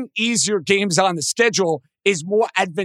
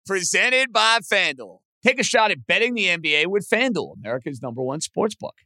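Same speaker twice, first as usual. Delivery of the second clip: ad read; 5 semitones lower than usual. Acted. Host reads sponsor ad.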